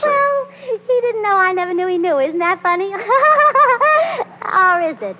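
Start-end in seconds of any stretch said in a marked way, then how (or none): 2.9-4.4 a high, bubbling giggle at her own joke; tickled wife giggling at her own silly remark; giddy laugh. Acted. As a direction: laugh delightedly, pleased with yourself.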